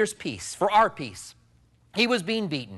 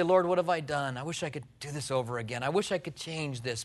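softly lamenting; 5 semitones lower, 8 dB quieter than usual. Acted. Sinner's lament voiced.

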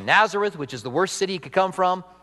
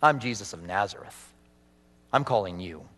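Jesus speaking; 9 semitones lower, 5 dB quieter than usual. Acted. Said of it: "And Jesus says".

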